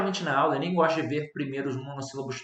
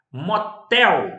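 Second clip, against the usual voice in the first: Motel with TEL punched high and loud; stress on last syllable; 5 semitones higher, 8 dB louder than usual. Shown as a demonstration. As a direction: hit the final syllable of Motel hard. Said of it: "Sílaba tônica, última". (Motel com a última sílaba, TEL, bem forte)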